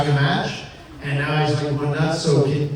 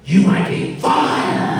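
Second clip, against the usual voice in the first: mock threat; 4 semitones higher, 3 dB louder, much slower than usual. Vince threatening on the phone.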